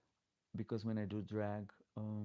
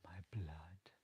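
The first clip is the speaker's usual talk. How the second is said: "My blood." whispered softly, breathy and low; 3 semitones lower, 8 dB quieter than usual. Pretend. Whispering the blonde slip back to chat. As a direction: hush "My blood." like a secret joke. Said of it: "repeats the slip in a whisper".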